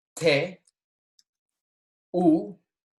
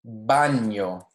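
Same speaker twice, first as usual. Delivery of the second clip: ordinary delivery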